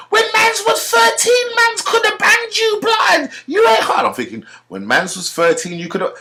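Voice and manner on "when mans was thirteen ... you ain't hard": high falsetto threat; hammed-up shrieking road-man; shrieking roadman. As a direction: squeal the threat way up high and loud. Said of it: "He said".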